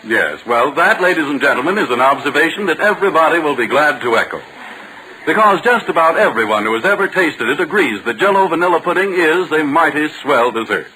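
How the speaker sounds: ordinary talk, nothing marked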